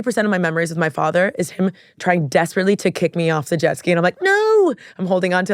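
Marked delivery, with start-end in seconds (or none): 4.2-4.7 mock panicked scream. Kid clinging to dad's jet ski, hammed-up panicked yell. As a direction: yelp like a kid about to be kicked off.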